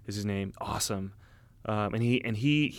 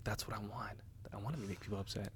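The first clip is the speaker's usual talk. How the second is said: hushed inner thought; 14 dB quieter than usual. Retold or imitated. Private thought while on phone.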